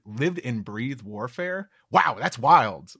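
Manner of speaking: wide-eyed, slowed-down awe; excited, childlike Luffy. Acted. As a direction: stretch it out in childlike amazement. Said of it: "Luffy's got this kind of excited, childlike".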